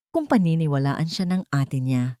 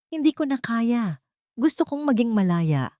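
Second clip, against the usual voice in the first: ordinary delivery, nothing marked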